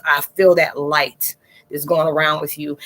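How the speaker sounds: normal talking voice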